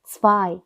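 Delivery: Zwei as "tsvai"; German zwei. Shown as a demonstration. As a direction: say Zwei with ts, then a v sound. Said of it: "영어와는 다르게 V 소리가".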